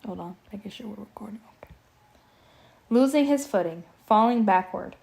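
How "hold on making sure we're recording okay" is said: hushed aside; whispering. Real, not acted. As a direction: whisper it quickly.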